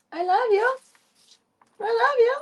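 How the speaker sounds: high squealed I love you; cooing to cat. Shown as a demonstration. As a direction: coo it to the cat.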